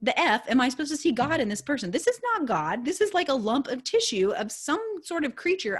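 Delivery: super worked up. Retold or imitated. Customer venting inwardly about the store clerk, super worked up. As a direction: protest in rising disbelief, retold. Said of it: "I'm, like, getting super worked up".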